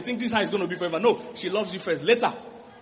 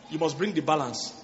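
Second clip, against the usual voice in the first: ordinary talk, nothing marked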